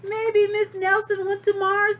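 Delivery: put-on high, loud childish guess; eager kid. Another kid guessing, high and loud. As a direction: pipe up like a child.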